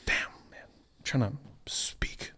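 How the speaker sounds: hushed, slow self-directed mumble; flustered muttering. Real, not acted. Dreamer loses his words mid-story, flustered muttering to himself. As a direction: grumble under your breath, barely audible.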